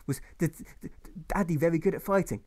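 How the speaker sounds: put-on a nervous little boy stammering; stammering small boy. Little boy nervously asking his mother about his father, stammering. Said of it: "Alfred once asked her".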